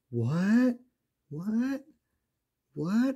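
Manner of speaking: high, rising, disbelieving whats; hammed-up shock. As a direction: overplay the stunned double take. Three heroes shocked by reveal.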